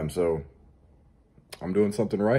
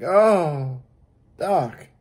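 hammed-up moan, raised pitch; mock loud moan; 6 semitones higher, 3 dB louder than usual. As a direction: moan it out playfully to the doctor. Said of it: "maybe moan real loud".

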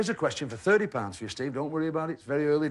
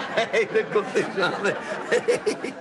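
laughing; 8 semitones higher, 3 dB louder, much slower than usual. Real, not acted. Host laughing at own joke.

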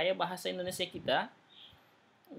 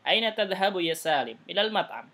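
louder, slow teacher reading with clear question and answer tunes; a touch slower and louder, each line shaped to its tune; model reading; 5 dB louder, slower than usual. Shown as a demonstration. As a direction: read the dialogue aloud as a model for the class. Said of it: "sesuaikan dengan nadanya".

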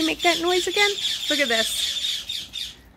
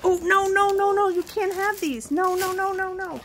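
higher, quick alarmed no to a pet; sudden no no no; 5 semitones higher than usual. Real, not acted. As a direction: stop the bird before it grabs them. Stopping the bird taking beads.

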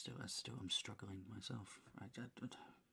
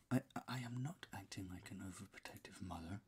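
whisper. Shown as a demonstration. Retaking the script line.

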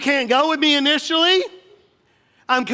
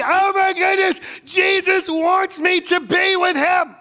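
higher and louder, exulting shout; preaching fire; 7 semitones higher, 4 dB louder than usual. Real, not acted. Pastor exults to the congregation, shouting joyfully. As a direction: preach it full force with joy. Preaching at full force, rising joy.

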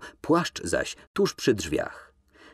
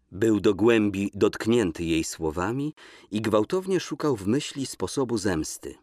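ordinary talk

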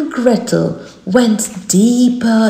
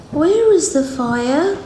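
put-on higher girl's voice; little girl voice; 6 semitones higher than usual. A narrator's reading. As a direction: ask innocently. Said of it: "said Gretel".

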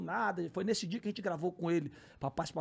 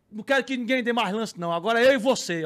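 louder, insistent throwing down the gauntlet; loud challenge; 11 dB louder than usual. Retold or imitated. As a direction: challenge a rival before a crowd. Acts host challenging him to duel. (desafio em voz alta)

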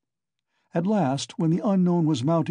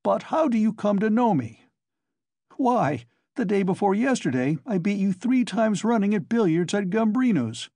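put-on higher, eager young man; 7 semitones higher than usual. A narrator's reading. Young newcomer chatting to Coke, eager. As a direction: lift it like a cheerful youth.

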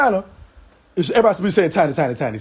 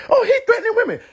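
high mock outrage voice through a laugh; hammed-up fake shock; mocking critics; 13 semitones higher, faster than usual. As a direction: squeal it like a scandalised gossip. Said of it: "they'll swear to God".

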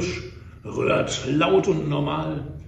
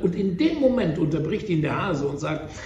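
usual speaking voice

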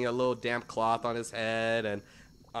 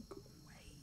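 unvoiced, hushed go away; whispered warning; 12 semitones higher, 22 dB quieter than usual. Goblin hissing at the boat to leave. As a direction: shoo them off in a frantic whisper.

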